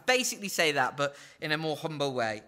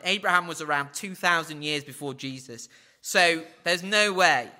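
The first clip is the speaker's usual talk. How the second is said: ordinary delivery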